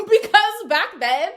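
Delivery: high, loud burst through laughter; laughing. Real, not acted. Explaining the couple's past to viewers, laughing loudly. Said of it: "so that's why I laugh".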